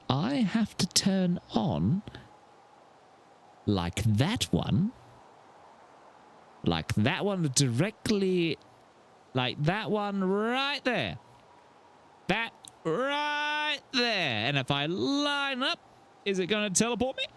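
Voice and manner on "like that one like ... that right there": stretched, slow pointing out; slow drawn out. Real, not acted. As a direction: drag each word out while aiming. Lining up with satellite.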